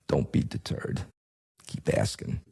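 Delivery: hushed, secretive whisper; whispered tip to a reporter; conspiratorial whisper. Pretend. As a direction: lean in and whisper like sharing a secret.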